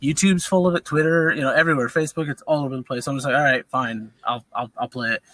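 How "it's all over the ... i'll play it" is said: usual speaking voice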